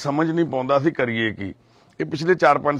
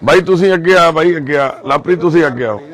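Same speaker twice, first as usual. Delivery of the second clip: calling out; 4 semitones higher, 8 dB louder than usual. Real, not acted. Calling people forward to camera.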